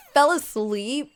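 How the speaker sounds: drawn-out, high, loud disbelief; slow shocked "Fell asleep?" with rising pitch; surprised. Real, not acted. Friend admits falling asleep.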